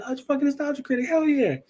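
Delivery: very high, fast, starstruck fan squeal; screaming and yelling. Retold or imitated. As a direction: lose it like a fan who just spotted his hero. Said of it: "screaming and yelling".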